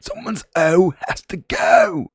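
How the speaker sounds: put-on London accent, high and slow; mock Cockney, drawn out. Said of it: "Was that a good London accent?".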